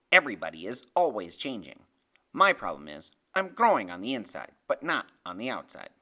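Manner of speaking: low, loud, emphatic explanation; knowing Freak. Acted. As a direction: pronounce it like a small philosopher. Narrator voices Freak explaining himself.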